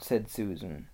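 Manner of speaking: ordinary delivery, nothing marked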